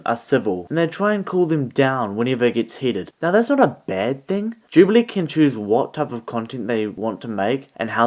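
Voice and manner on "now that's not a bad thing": normal talking voice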